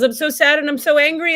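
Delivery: the exiled part breaking open, loud and high; anguished outburst. Acted. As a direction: let it all burst out. Part speaks again, more strongly.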